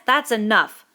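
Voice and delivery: a curt stop; sharp. Acted. Ralph silencing Piggy, sharp. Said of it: "said Ralph sharply".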